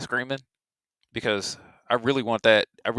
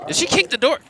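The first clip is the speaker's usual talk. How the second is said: shocked laughing question. Real, not acted. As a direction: gasp it out and burst into laughter. Reacting to clip.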